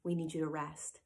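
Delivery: gentle guides' voice. Retold or imitated. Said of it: "Is it a blanket statement?".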